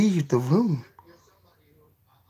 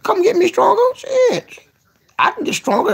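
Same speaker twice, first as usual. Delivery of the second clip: high excited squeal; 3 dB louder than usual. Real, not acted. Flirty excitement.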